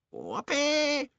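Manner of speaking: very high whooping crone; gleeful old hag. Acted. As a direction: whoop it like a crazed witch. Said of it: "she shouted".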